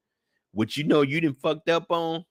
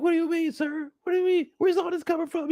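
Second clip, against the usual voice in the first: higher, playing dumb; high innocent protest. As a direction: act innocent to the boss. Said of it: "Burke is like".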